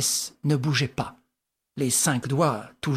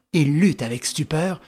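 ordinary delivery, nothing marked

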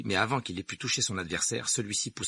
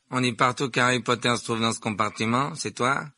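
put-on louder, self-important boy; lightly voiced haughty schoolboy; haughty Malfoy; 4 semitones higher, 7 dB louder than usual. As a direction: address him boldly, with raised volume. Narrator voices Malfoy in dialogue.

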